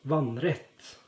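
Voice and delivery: vannrett said the Norwegian way, rising at the end; Norwegian word. Shown as a demonstration. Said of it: "but we also say".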